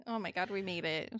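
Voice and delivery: ordinary talk, nothing marked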